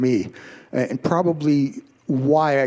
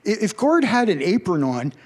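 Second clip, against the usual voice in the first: usual speaking voice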